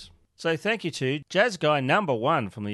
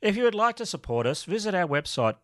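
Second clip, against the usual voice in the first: normal talking voice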